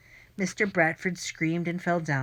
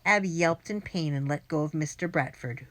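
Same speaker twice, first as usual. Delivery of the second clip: plain reading voice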